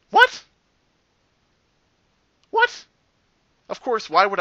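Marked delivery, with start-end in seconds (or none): 0.1-2.8 very high, loud squeaked what; baffled yelp. Real, not acted. Player reading a garbled chat message, baffled high squeak. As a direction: squeak it out in disbelief.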